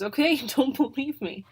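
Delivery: laughing. Acted. Pivo laughs off accusation.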